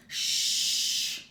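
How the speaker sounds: long loud unvoiced shh; bear shushing. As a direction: hush Mouse urgently. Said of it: "exclaimed Bear".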